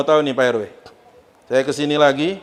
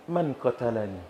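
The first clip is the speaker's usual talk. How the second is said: faint question; 4 semitones lower, 11 dB quieter than usual. Acted. Dying Umar asks killer.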